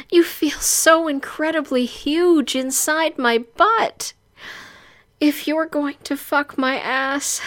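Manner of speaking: high cry, then breathless pleading; pained pleading heroine. Acted. Bound heroine begging her captor, high and distressed.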